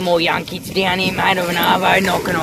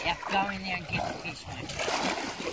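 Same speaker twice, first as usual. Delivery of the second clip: mock echo; 12 dB quieter than usual. Mocking his mate's words.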